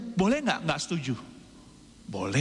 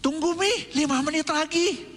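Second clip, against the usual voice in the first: higher, put-on kid voice begging for time; stalling child; 7 semitones higher than usual. Child answering mom's call, higher put-on stalling voice. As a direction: stall mom like a kid mid-game. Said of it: "Kalau dipanggil, hah?".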